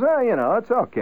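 a shrug about his new Mercury; Zudak. Retold or imitated. Zudak answers about his car.

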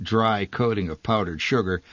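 usual speaking voice

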